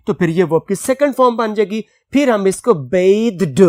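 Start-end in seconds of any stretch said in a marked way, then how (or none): 2.7-3.6 "बेद्ड" said with a long e and a closing d; pron as bathed. Shown as a demonstration. Teacher showing the past form is read "बेद्ड". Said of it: "पढ़ेंगे".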